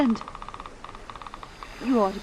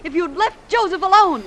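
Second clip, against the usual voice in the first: higher and louder, scolding; angry reproach; 8 semitones higher, 5 dB louder than usual. Acted. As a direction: tear into him for his impatience. Stephanie berates Felix.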